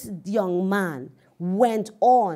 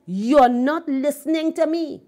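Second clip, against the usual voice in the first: retold preacher's rebuke, louder and higher; emphatic rebuke; 6 semitones higher, 7 dB louder than usual. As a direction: raise voice and pitch to demand attention. Preacher rebukes an inattentive man.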